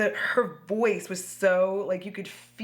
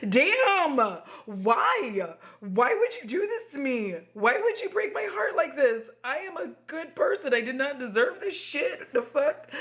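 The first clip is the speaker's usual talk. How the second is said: high-pitched, hammed-up heartbroken protest; heartbroken outburst; 7 semitones higher than usual. Reliving a reaction to the movie's ending, hammed-up heartbreak. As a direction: plead in a mock wail. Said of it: "I was so heartbroken by that moment".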